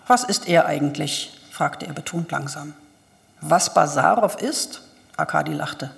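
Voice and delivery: calm, even audiobook reading with lightly voiced characters; measured literary narrator reading Turgenev; story narration. A narrator's reading. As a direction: read the novel aloud, steady and clear.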